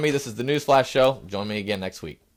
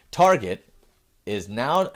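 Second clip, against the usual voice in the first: ordinary talk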